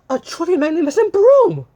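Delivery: high, loud amazement; 1 amazed. Acted. Reader showing a child the broom, amazed. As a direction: marvel at the broom.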